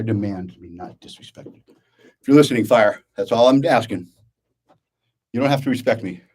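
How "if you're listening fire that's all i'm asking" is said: calling out. Real, not acted. Calls out to someone called fire.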